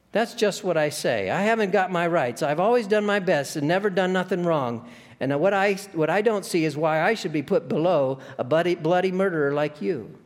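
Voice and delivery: bristling self-righteous ghost, proud of a plain honest life; aggrieved ghost. A narrator's reading. Ghost protests being put below.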